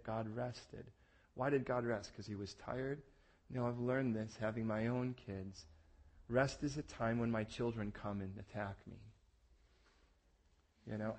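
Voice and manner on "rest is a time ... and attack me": normal talking voice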